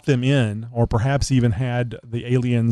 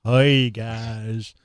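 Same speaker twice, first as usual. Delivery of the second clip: put-on Texas accent. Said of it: "We can talk like we're from Texas".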